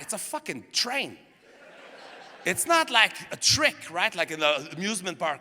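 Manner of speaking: normal talking voice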